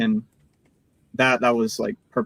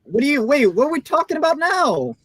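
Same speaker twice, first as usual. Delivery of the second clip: baffled; 12 semitones higher than usual. Real, not acted. Confused protest.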